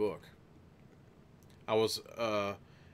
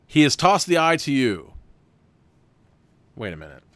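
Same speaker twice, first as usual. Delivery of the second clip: normal talking voice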